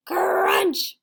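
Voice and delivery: loud crunch sound effect. Acted. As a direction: punch the crunch loudly. Sammy bites painting.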